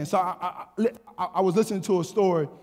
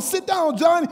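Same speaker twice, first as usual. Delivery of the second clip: scolding mom; 7 semitones higher than usual. Retold or imitated. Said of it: "his mom is pulling".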